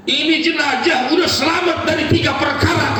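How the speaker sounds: high, loud declaration; solemn proclamation. Acted. The Prophet telling the mother, loud and high. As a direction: announce it with full force.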